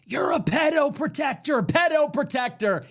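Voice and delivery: high mocking heckler shout; retold yell. Factory worker heckles the president from the floor, high shouted jeer. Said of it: "yells up to him".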